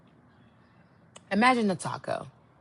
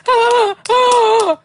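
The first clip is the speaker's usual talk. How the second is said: high, hammed-up grunting moans; mock his silly moans; mock moaning; 12 semitones higher, 7 dB louder than usual. Imitating the man's moans, high and hammed-up. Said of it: "That's him, by the way".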